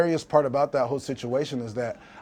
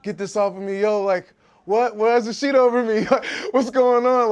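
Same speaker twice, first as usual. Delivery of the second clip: panicked yell, much higher and louder; retold screaming; 9 semitones higher, 10 dB louder than usual. Waking strapped under a sheet in hospital, panicked high scream. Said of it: "I'm screaming".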